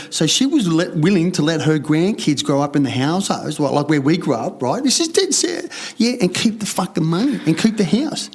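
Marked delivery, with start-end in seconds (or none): 4.8-7.8 much higher, insisting it's true; emphatic. Real, not acted. Telling the interviewer it's true, emphatic and higher. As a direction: swear it's the honest truth.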